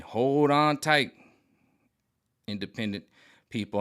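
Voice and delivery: slow, drawn-out warning. Acted. Warning independent people, slow and drawn-out. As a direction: stretch it out like a dire warning.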